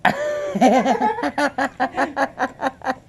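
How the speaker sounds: laughing high through the line. Real, not acted. Dad laughs at baby unfazed by Pop Rocks, high laughing. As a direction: laugh it out, amused.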